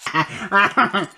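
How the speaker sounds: gleeful mad scientist laugh; evil cackle. Acted. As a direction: cackle over the experiment. Cereal combined, gleeful.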